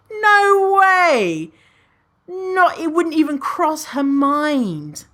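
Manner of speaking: emphatic no way. Real, not acted. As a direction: reject it emphatically. Emphatic denial about friend judging.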